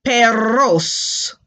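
"Perros" drawn out with a trilled R; long, trilled R in "Perros"; rolled R. Shown as a demonstration. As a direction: hold and roll the R in "Perros". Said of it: "roll the R".

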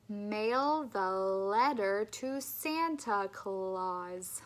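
"love life" with slow, clear L; slow, careful speech-therapy model; slow clear L drill. Shown as a demonstration.

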